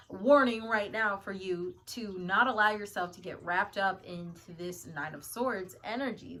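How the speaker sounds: usual speaking voice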